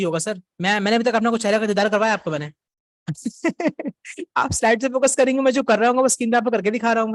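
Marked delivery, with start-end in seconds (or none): 3.1-4.3 short, higher-pitched chuckle. Real, not acted. Teacher amused by students' webcam question, chuckling. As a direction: let out a brief amused laugh.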